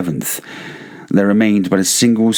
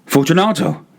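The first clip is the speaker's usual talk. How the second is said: calling again; 10 semitones higher than usual. A narrator's reading. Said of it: "I called again".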